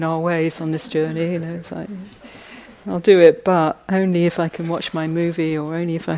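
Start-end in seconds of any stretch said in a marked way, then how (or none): 2.9-6.2 mock bargaining.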